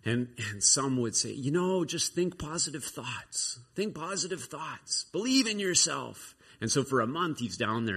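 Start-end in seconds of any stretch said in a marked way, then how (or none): 1.3-6.4 high, slow, drawn-out platitudes; mock self-help pep talk. Passers-by giving self-help to the trapped man, mock encouragement. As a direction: parody an empty motivator, pitched up. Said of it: "giving him all this self-help".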